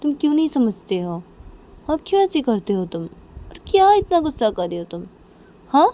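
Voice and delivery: exasperated confrontation. Acted. Manisha confronting Nisha about the family fights, exasperated. Acted quarrel, pleading to be understood.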